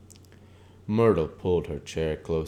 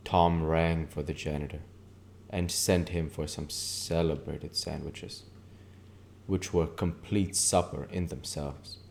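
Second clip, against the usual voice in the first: ordinary delivery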